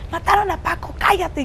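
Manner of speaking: high, loud, desperate cry; retold frantic shout. Coordinator shouting to Paola that Paco was killed, high and loud. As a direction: blurt the terrible news at her. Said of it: "empezó a gritar como loca".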